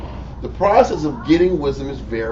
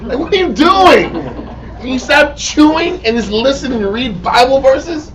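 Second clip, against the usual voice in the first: high, incredulous outburst; exasperated scolding; 9 semitones higher, 3 dB louder than usual. Acted. Teacher to someone chewing through Bible verses, exasperated. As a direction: scold them, pitched way up.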